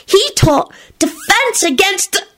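put-on high, drawn-out little-boy voice; interrupting child. A narrator's reading. Teddy interrupts the adults to answer, high-voiced. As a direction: butt in with a slow, high announcement. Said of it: "Teddy interrupted them immediately".

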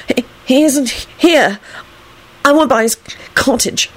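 high, stammering irritation; reply testily, tripping on the first word; irritable. A narrator's reading. Melvin correcting Alex, irritable. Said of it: "Melvin replied irritably".

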